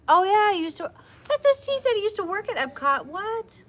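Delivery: can't believe the coincidence; surprised delight. Real, not acted.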